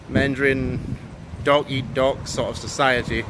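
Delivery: usual speaking voice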